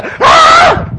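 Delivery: piercing high shriek; mock scream. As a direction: let out a terrified shriek. Disciples screaming in the storm.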